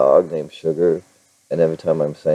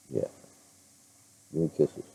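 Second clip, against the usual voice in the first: soft, tender ask for kisses; 2 semitones lower, 12 dB quieter than usual. Real, not acted. Owner softly coaxing kisses from her dog. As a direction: coax the dog sweetly.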